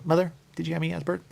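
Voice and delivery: put-on high quick child's question. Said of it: "You go".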